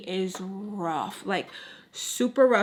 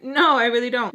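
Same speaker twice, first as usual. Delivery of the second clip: mildly emphatic retold protest, higher and louder on "no"; insistent denial; 3 semitones higher, 5 dB louder than usual. Girl denying it to her friend's mom, insistent. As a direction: push back with a louder denial.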